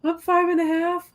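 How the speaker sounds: lightly voiced kid bragging about the half; little kid voice. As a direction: say it like a proud small kid. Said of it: "little kids do it when they're like".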